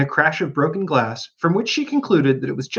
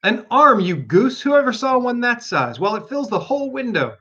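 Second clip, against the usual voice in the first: scolding; 8 semitones higher than usual. Acted. Rabbit scolding Pat.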